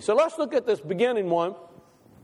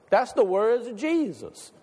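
ordinary talk

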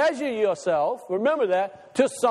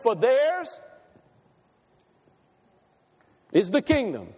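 "theirs" held up, long pause, then a firm "is"; stress on IS. Shown as a demonstration. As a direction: pause before "is" and land on it. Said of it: "He's emphatic about what he says".

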